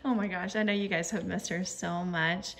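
normal talking voice

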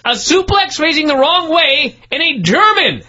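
high, drawn-out exasperation; incredulous rant. Real, not acted. Host recapping two moves into the tacks, incredulous. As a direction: hammer out the stupidity in a high-pitched voice.

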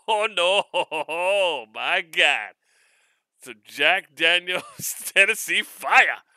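loud laughing delight; loud, slow, laughing joy; laughing excitedly. Real, not acted. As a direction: crack up at the surprise gift. Unboxing a surprise bottle.

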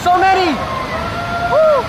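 ordinary talk, nothing marked

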